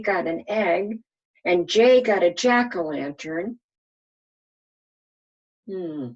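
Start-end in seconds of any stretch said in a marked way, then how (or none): none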